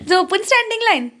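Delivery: laughing out the words; amused laugh running into the line; real laugh, then the words. Real, not acted. Mom laughs at child lying down.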